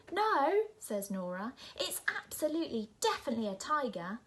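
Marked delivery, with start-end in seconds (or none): 0.1-0.7 high loud insistent no; emphatic protest. Acted. Nora protesting to grandma, loud and high. 1.8-4.2 fast, eager, certain; eager insistence. Acted. Nora insisting to grandma, fast and eager.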